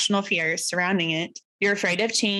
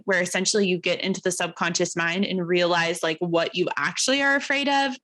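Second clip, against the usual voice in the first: usual speaking voice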